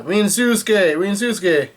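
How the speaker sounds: mock yell. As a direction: call the name out, not really shouting. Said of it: "the guys yell".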